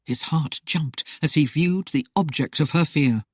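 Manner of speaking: usual speaking voice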